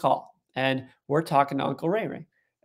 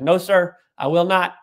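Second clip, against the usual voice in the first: firm retold refusal; 4 semitones higher, 5 dB louder than usual. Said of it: "He's like".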